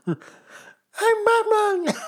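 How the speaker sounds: high strained laughing Batman line. Acted. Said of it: "Like Batman".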